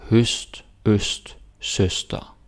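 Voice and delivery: teacher modelling "Høst, øst, søster" clearly; Danish pronunciation. Shown as a demonstration. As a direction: Danish word demo. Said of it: "here we got Ø S T".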